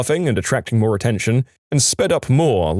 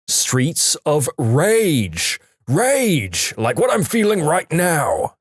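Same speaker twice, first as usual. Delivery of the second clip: slow, drawn-out game title, then higher-pitched frustration; exasperated outburst; 6 semitones higher, much slower than usual. Retold or imitated. Husband repeating game title to tech support, exasperated. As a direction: spell it out in mock exasperation. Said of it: "the tech couldn't understand him".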